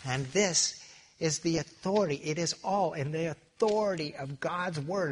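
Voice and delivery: mellow preacher holding up the Bible; solemn mock declaration of authority; Bob Hoekstra. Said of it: "he's got this real mellow voice".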